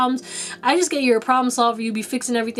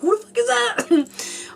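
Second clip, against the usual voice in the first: much higher, slow, incredulous question; mock startled reaction; 11 semitones higher, slower than usual. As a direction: act taken aback, pitch shooting up. Acted startled reaction to loud voice.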